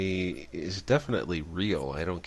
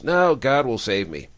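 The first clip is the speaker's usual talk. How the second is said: acted yell, higher and louder, falling away; raised-voice holler of refusal; 5 semitones higher, 6 dB louder than usual. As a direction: call back a stubborn refusal from the house. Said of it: "he's yelling out the house".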